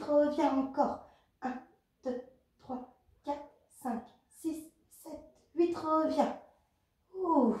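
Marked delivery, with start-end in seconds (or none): 1.4-5.7 compte les répétitions à voix basse, régulier; égrène les temps doucement, en coach; comptage des répétitions. Acted. Coach counts the exercise reps. (compter les répétitions doucement)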